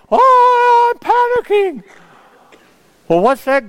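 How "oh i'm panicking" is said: shrill high squeal of fake panic, hammed-up; mock panic. Preacher mocking a panicking believer, hammed-up mock panic. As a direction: whine it like a frantic worrier.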